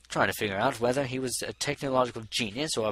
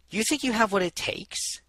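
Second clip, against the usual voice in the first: sceptical; 10 semitones higher than usual. A narrator's reading. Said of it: "arching an eyebrow".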